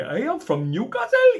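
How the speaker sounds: high, loud hammed-up Geordie; bad Geordie accent. Comic mocking everyone's Geordie impressions, exaggerated Geordie accent. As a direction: overdo a Geordie accent, shrieking upwards. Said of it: "everyone loves doing a Geordie".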